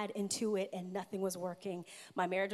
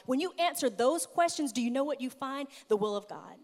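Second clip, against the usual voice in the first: loud, higher, building sermon voice; passionate preaching; 3 semitones higher, 6 dB louder than usual. Acted. Preacher building to a climax for the congregation, loud and high.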